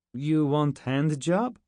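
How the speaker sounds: put-on slow, deadpan Russian accent; Russian soldier misreading the commander. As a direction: offer it earnestly in broken English. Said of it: "This one was Russian".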